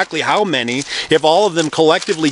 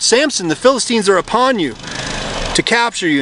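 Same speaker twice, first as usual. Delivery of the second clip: mild cry of alarm, pitched higher; 6 semitones higher than usual. A narrator's reading. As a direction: call out a warning without really shouting. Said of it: "then she cried out".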